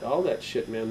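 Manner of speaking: normal talking voice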